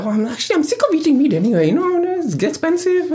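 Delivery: high, light imitation of mum; mum's voice, laughing; quoting mum. Mum quoted, high mocking voice.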